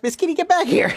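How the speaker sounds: high sharp call after a cat. Real, not acted. Teacher calling Miss Kitty back, high and sharp. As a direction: call her back as she runs off.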